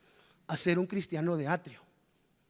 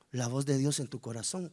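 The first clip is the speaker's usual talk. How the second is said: ordinary talk, nothing marked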